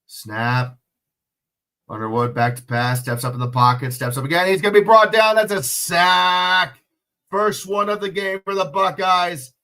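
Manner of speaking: fast sportscaster call, rising to a loud peak on the sack; play-by-play call. Acted. Live play-by-play of the game.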